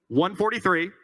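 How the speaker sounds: ordinary talk, nothing marked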